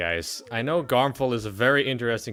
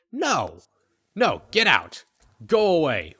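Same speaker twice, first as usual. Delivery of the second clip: higher, louder, bursts of rejection; mock angry refusal; 4 semitones higher, 5 dB louder than usual. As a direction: send the neighbor packing, hammed-up. Acted grouch rejects neighbor's offer.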